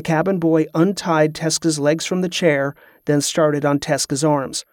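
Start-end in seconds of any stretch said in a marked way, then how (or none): none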